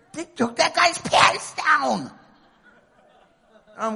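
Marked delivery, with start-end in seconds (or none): 0.1-2.1 high, loud, drawn-out astonishment; hammed-up crowd shock; mock shocked onlooker. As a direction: exclaim it slowly, scandalised and amused. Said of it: "Everyone's kinda looking like".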